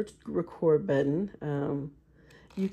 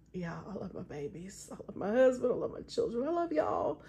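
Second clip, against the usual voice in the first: a little choked up, some words hushed; holding back real tears while saying I love you; getting teary; 4 semitones higher, 6 dB quieter than usual. Real, not acted. Welling up telling viewers she loves her husband and children, teary. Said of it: "now I'm getting all teary".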